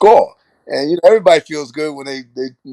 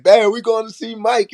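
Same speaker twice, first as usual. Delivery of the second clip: put-on excited kid; 8 semitones higher than usual. Kid celebrating win.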